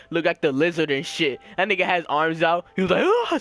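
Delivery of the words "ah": high mock scream; short high "ah", mimicking a scream at the sky; imitated scream. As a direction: scream it high without full volume. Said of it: "screaming in the sky and shit".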